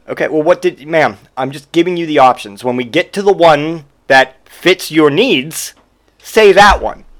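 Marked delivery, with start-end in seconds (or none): none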